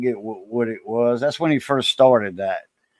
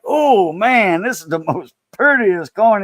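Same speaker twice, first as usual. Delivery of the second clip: higher-pitched mock amazement; imitated thrilled awe; 5 semitones higher, slower than usual. Coin dealer marveling at the prettiest penny, imitated excitement. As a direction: imitate someone thrilled by a stunning find. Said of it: "he had the frisson".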